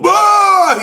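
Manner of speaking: high, full-out shout; excited whoop. Retold or imitated. Fan reliving his outburst at the bar, excited shout. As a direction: holler it like a fan at a huge moment. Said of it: "I remember going".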